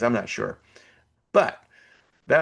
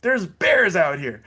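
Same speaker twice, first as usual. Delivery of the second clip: warning; 7 semitones higher, slower than usual. Retold or imitated. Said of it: "everyone's like".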